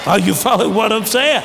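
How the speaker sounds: preacher pushing for a response; calling out. Real, not acted. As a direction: call out to the crowd, high and strong. Preacher calls out to congregation.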